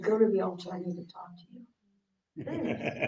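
slow, whispered summons; God calling her softly to the altar; hushed divine call. Retold or imitated. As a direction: breathe it like a secret call from God.

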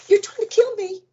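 high, strained accusing voice; mock-hysterical accusation. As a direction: accuse them in a high wail. Accusing the nurse.

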